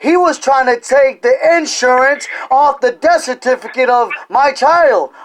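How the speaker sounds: excited then slowing down at the end; sudden realization. Real, not acted. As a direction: gasp as it suddenly clicks. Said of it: "I just understood something".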